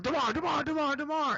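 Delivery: imitating screaming fans. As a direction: call the name like an eager crowd. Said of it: "screaming his name".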